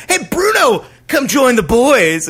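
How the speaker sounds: high, slow mock invite; cheesy band guy. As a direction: call him over like a hammy bandmate. Pretending to invite Bruce Willis.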